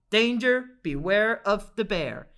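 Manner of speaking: reading the sign as a loud alarm; warning sign read out. Acted. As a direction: boom it like a danger sign.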